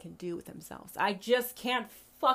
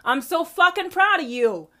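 louder and higher, bursting with pride; proud shout; 8 semitones higher, 12 dB louder than usual. Retold or imitated. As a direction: shout it like a cheering fan. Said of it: "comment, comment, comment".

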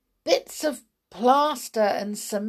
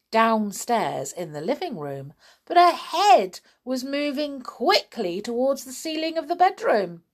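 usual speaking voice

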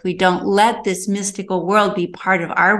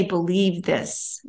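soft retold plea; 7 dB quieter, slower than usual. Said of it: "she needed to say".

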